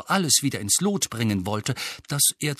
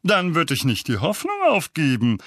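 higher, spiteful jab; Onkel Vernon, spiteful; 4 semitones higher, 3 dB louder than usual. A narrator's reading. Uncle taunts Harry about the hearing, spiteful. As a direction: needle him with malicious relish. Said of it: "sagte Onkel Vernon gehässig".

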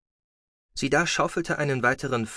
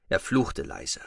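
ordinary delivery

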